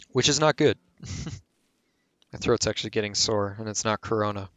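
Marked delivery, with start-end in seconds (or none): none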